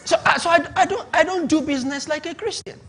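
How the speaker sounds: amused confession mid-sermon; laughing. Real, not acted. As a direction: chuckle while you admit it. Laughing through his point.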